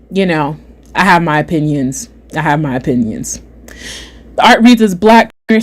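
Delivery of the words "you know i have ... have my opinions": low, soft, reserved delivery; guarded understatement. Real, not acted. Streamer keeping their opinions from viewers, guarded and low. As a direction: say it low, holding back. Pointedly withholding own opinion.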